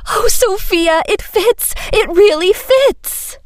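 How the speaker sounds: very high, delighted cry; tearful delight. A narrator's reading. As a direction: gush with teary joy. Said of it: "tears of delight".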